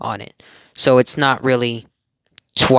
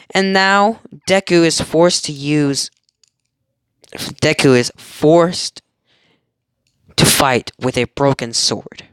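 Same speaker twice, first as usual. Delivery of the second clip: ordinary talk, nothing marked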